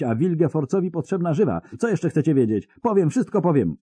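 high, fast, desperate pleading; panicked babbling. Acted. Captured spy begging his torturers, panicked babble. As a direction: spill it all before the iron touches you. Terrified spy blurts everything.

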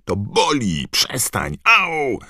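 loud, high yelp of pain; pained cry. A narrator's reading. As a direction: cry out as he is jabbed. Harry jabbed in the ribs. (głośny okrzyk bólu)

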